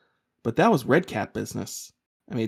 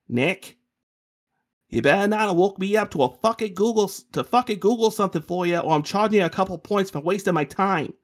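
raised high, threatening irritation; aggravated; 8 semitones higher than usual. Acted. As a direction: scold him for waking you. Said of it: "Chenzo sounds aggravated".

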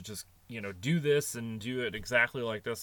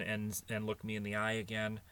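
normal talking voice

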